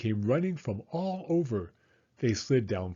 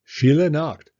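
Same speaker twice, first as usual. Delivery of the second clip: ordinary delivery, nothing marked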